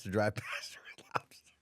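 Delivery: soft unvoiced giggle-aside; laughing whisper. Real, not acted. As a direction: whisper the punchline through a laugh. Teasing aside.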